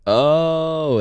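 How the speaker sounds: high, loud, drawn-out "oh"; impressed reaction. Real, not acted. Co-host reacting to a big moment for her, impressed. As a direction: let a long oh soar up, impressed.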